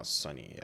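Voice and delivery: "Sony" said as SUH-nee; mispronounced Sony. Shown as a demonstration. Correcting own accent to viewers, "Sony" wrongly said SUH-nee. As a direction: say "Sony" like "sunny". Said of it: "I heard it's called Sony, not Sony".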